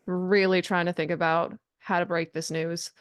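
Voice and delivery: normal talking voice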